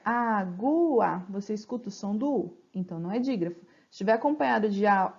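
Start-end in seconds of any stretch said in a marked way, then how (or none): 0.0-1.2 água drawn out slowly so the U is heard, a-gu-a; slow, spelled-out água. Shown as a demonstration. Teacher slowing água down for the class so the U is heard. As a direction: stretch água syllable by syllable. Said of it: "se eu falar bem devagar".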